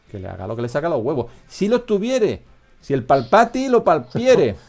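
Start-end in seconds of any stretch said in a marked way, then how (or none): 1.5-4.6 much higher, slow, pompous mock-archaic chant; mock solemn recitation. Host mocks the absolute monarch in mock-archaic verse, high and pompous. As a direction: declaim it like a grand old decree.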